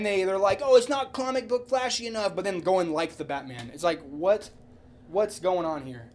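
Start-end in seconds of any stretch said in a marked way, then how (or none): none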